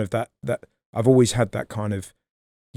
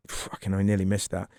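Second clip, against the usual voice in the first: inner voice quietly; 5 semitones lower, 6 dB quieter than usual. Retold or imitated. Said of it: "his brain went".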